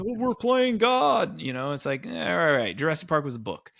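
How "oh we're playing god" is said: high, slow, whiny mock voice of a naysayer; mocking critic. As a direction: parody a hand-wringing critic.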